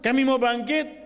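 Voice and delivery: high-pitched cry of grievance; voice of the oppressed. Acted. The oppressed recounting their expulsion and killings, high aggrieved plea. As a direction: cry out, each complaint pushed up high. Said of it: "menceritakan orang-orang yang mazlum".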